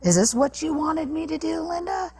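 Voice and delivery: imitating an angry father taunting his wife; dad's taunt. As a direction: sneer at Linda as the raging father. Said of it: "And he's like".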